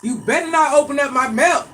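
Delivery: high, loud threatening call; loud warning. Real, not acted. Parent warning the family off the milk, loud shout. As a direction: shout the warning so the whole house hears.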